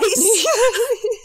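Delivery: a high, loud burst of laughter; high shrieking laugh. Real, not acted. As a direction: laugh hard, almost crying. Laughing at the jab.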